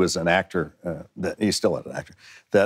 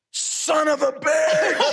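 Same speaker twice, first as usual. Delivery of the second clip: shocked outburst; much slower than usual. Retold or imitated. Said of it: "he just looked at me and went".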